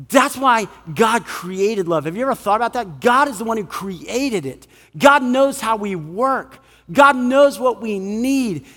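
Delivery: usual speaking voice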